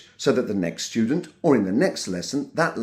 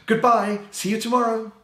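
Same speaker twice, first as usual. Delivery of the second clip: high, bright-voiced goodbye, much higher; excited demo; 11 semitones higher than usual. Shown as a demonstration. Teacher showing the class an excited goodbye. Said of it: "Or excited".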